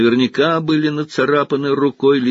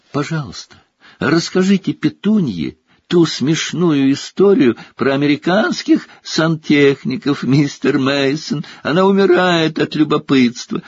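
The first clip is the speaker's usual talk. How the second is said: high, ingratiating host voice; sucking up to the guest, higher; fawning host; 6 semitones higher, 3 dB louder than usual. A narrator's reading. Uncle flatters guest.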